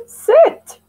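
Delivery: the last number jumps high and loud; excited count. Shown as a demonstration. Teacher counting birthday candles with children, excited finish. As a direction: land on the final number with a burst of excitement.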